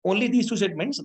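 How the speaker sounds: ordinary talk, nothing marked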